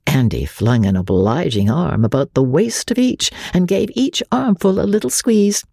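ordinary delivery

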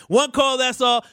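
high, sing-song catchphrase; slogan call-out. Acted. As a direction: sing it out like the contest hook. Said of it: "slogan repeated as the hook".

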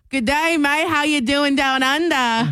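slow, hammed-up Aussie greeting; broad mock Australian; Australian accent. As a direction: greet them as a stock Australian.

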